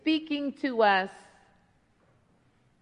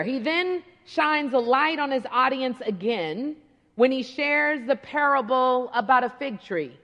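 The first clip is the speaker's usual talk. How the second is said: ordinary delivery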